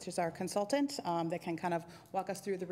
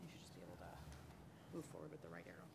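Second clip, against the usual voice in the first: hushed, breathy aside off to the side; whispered aside; 18 dB quieter than usual. Real, not acted. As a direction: murmur the instruction so the room won't hear. Quiet aside to the presenter.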